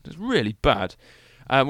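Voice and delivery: usual speaking voice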